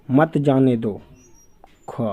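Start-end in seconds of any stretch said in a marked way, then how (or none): 0.1-0.9 मत जाने दो run together after the break; मत जाने दो as one falling phrase; pause before मत. Shown as a demonstration. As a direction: keep मत joined to जाने दो. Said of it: "अल्प विराम चिन्ह का प्रयोग अलग-अलग स्थानों".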